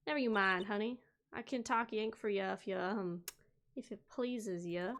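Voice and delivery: put-on light Southern accent, bold and sassy. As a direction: play her as a feisty Southern gal. Voices Southern witness Lotta.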